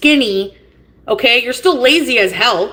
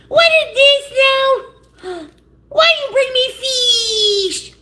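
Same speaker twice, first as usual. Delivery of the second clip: high squeaky mock voice talking to food; high, slow, playful put-on voice; 13 semitones higher, slower than usual. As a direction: squeak it like an offended little creature.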